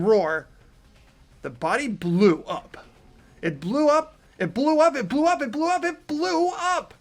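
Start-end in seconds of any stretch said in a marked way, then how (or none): 3.4-6.9 rising higher and louder into a frantic panic; freaking out. Acted. Reading a visual novel, the narrator panics at the blast, freaking out. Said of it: "so I try and say it like I'm freaking out".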